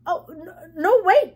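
high, loud, stammered protest; urgent cry. A narrator's reading. Girl stopping a departing guest, urgent cry. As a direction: cry out to stop someone. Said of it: "cried Jody".

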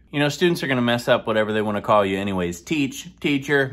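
ordinary talk

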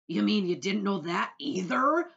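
very loud, slow, jeering disbelief; scornful centipede. A narrator's reading. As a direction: pour scorn on the boy's ignorance. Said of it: "the centipede said scornfully".

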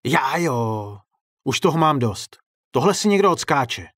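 fed-up angry outburst; furious. A narrator's reading. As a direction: vow payback in a temper. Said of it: "řekl vztekle ředitel".

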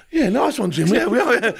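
high, laughing mock agreement; laughing sarcasm. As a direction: tease him through a laugh. Sarcastic reply to Jim.

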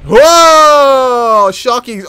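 long, loud, high whoa; awed yell. Real, not acted. Player seeing a shark attack, awed yell. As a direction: holler it out in amazement.

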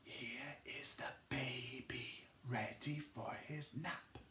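soft whisper to the class. Shown as a demonstration. Teacher whispering the rhyme to a sleeping baby. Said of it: "be a bit more gentle".

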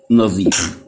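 usual speaking voice

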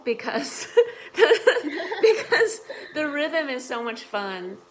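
giggling through the words; laughing while talking. Real, not acted. Giggling about the song.

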